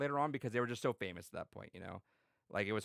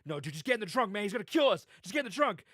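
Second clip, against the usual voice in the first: high, urgent mock panic; retold urgent pleading; 9 semitones higher, 4 dB louder than usual. Friend urging victim into the trunk, high panicked plea. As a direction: plead as if your life depends on it.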